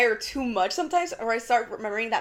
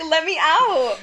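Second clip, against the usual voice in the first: mock plea; 4 semitones higher, 8 dB louder, much slower than usual. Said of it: "You're being so dramatic".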